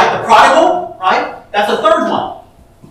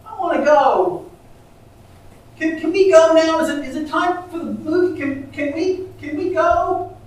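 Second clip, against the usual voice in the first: put-on higher, whining little boy begging to leave; whiny child voice; 8 semitones higher than usual. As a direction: whine like a tired four-year-old. Four-year-old whining in story.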